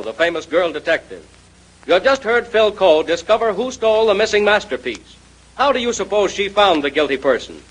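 usual speaking voice

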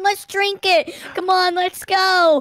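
ordinary talk, nothing marked

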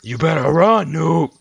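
loud, high taunting threat; in-game voice. Pretend. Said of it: "that's my in-game voice".

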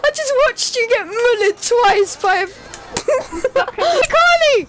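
slow words broken by laughter; laughing. Real, not acted. Player laughing at friend getting hit. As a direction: laugh through the line.

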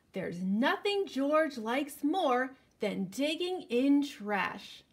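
put-on slow, lively storybook reading for kids; reading to children. A narrator's reading. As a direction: read it out like story time for little ones. Reading picture book to children.

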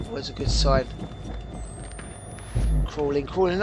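low, soft hushed murmur. Real, not acted. Gamer crawling through dark library, hushed murmur. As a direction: keep the voice down while sneaking.

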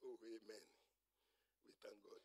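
low, barely audible thanks; muted response. Retold or imitated. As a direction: imitate a congregation's half-hearted praise. Imitates lukewarm response to baptism.

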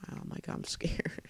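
hushed, nervous scared aside; very quiet, with a small nervous laugh. Real, not acted. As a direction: say it under the breath, uneasy.

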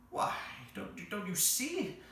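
high, urgent creature voice; exasperated creature. Acted. The Psammead answers Jane's why, exasperated. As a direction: stammer it out as if it's obvious.

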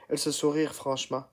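ordinary delivery

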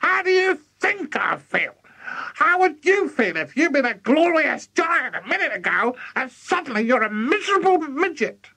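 high-pitched furious granny rant; high, outraged granny tirade; yelling Grandma. A narrator's reading. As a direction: screech indignantly like a shrunken old woman. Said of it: "she yelled".

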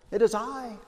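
lightly voiced temptress calling him back; a hint of a coaxing mistress; alluring voice. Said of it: "in her most alluring voice".

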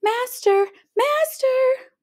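high, loud alarmed cry; calling out. A narrator's reading. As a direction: cry out for help. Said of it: "the harp cried out".

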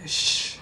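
long hissing shh; sh sound. Shown as a demonstration. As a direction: push out a steady shh. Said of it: "Practice the sh sound".

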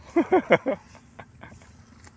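a long falling burst of laughter; loud high laugh. Real, not acted. Laughing loudly after the struggle with the eel. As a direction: laugh out big, then let it drop.

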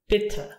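Bitte with a quick open I, as in "bit"; short I. Shown as a demonstration. Teacher repeating short I for learners, Bitte with I short. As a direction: keep the I of Bitte brief. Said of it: "And the short version".

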